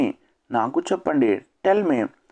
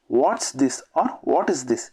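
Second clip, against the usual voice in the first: slow careful comparison; much slower than usual. Shown as a demonstration. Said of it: "teacher compares two forms slowly".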